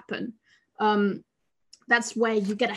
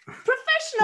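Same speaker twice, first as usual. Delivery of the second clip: mock exclamation; 13 semitones higher than usual. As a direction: shout the word in mock pride.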